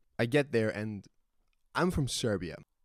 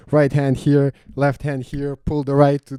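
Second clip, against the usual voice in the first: loud, higher, acted-out emergency steps; urgent drill; 4 semitones higher, 11 dB louder than usual. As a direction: fire off the steps as if mid-fall. Acting out emergency drill under pressure.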